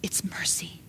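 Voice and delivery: softly spoken, voice dropped low; quiet, voiced and tender. Real, not acted. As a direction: say it gently under the breath. Soft repeat before a whisper.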